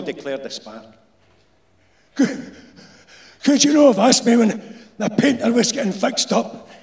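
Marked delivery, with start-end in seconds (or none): 2.1-6.7 put-on high, loud annoyed old Glaswegian; irritated old insulator. A narrator's reading. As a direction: grumble it, fed up. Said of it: "said the irritated insulator".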